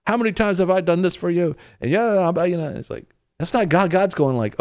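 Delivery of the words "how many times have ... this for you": exasperated God. Retold or imitated. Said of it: "most people would think".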